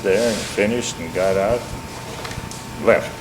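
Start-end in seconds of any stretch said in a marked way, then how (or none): none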